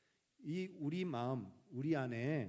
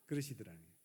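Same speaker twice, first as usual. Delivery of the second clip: normal talking voice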